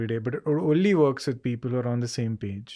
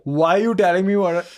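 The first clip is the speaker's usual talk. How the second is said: louder and higher indignant retort; mock defensive snap; 5 semitones higher, 7 dB louder than usual. Imagined partner snapping back at a complaint, loud. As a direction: throw it back at him, offended.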